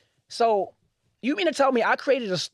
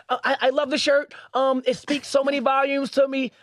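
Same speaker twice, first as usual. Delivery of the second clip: higher, hesitant pleading voice; customer pleading; 7 semitones higher than usual. Retold or imitated. Imitating the customer pleading with the brand on the phone, high and hesitant. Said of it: "she leaned in and she was like".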